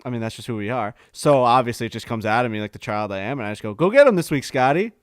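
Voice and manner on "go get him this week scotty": loud fanboy shout across the range; calling out. Retold or imitated. Fan calling out to a passing golfer, loud excited shout. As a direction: yell it out like an excited kid. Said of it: "we really don't call out to guys".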